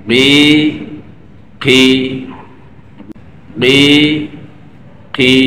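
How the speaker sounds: গি and খি each said alone; separate syllables. Shown as a demonstration. Teacher modelling separate syllables. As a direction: sound গি then খি with clear pauses. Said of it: "এবার আলাদা".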